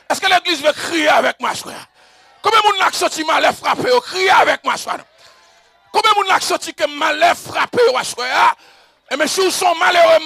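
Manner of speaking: loud, high rallying shout to a long-held alléluia; full-force preaching. Acted. Preacher calling the church to cry out, full-force preaching. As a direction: rouse the whole church, building to a huge cry.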